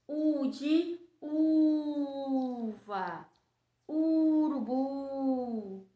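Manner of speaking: very slow, stretched "U de uva"; drawn-out U. Shown as a demonstration. Teacher drawing out the U for children. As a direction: stretch the U for little kids.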